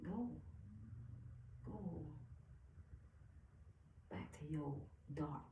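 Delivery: hushed, spaced-out command; soft, deliberate dismissal; quiet banishment. Acted. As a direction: send it away softly and firmly.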